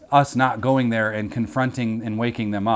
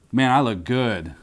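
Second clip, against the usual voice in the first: arrogant self-admirer; 3 semitones lower, 4 dB quieter than usual. Pretend. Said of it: "arrogantly impressed with himself".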